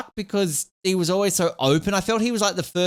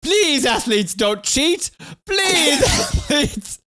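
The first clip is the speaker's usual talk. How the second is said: a high, pleading official's plea; mock pleading; 8 semitones higher, much slower than usual. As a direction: beg the athletes, hammed-up and desperate. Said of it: "he's just begging everyone".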